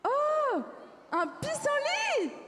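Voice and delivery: high, drawn-out squeal of wonder; excited discovery. Acted. Finding a dandelion in the desert, excited cry. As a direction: gasp out delight, stretching the words high.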